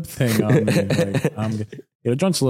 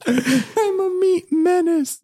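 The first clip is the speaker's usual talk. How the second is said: mock repeat; much slower than usual. Mocking friend's line back.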